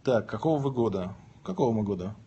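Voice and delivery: ordinary talk, nothing marked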